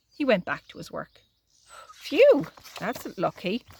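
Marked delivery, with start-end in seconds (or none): 1.9-2.5 high loud sigh of relief; relieved phew. Acted. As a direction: blow out the relief. Relief after McGregor leaves.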